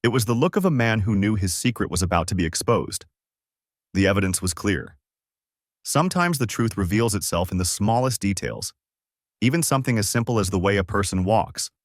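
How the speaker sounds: ordinary delivery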